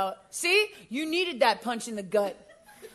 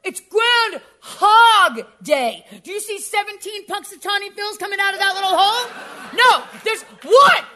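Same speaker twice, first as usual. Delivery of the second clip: outraged shout; 8 semitones higher, 13 dB louder, slower than usual. Acted. Mock outrage over the holiday name.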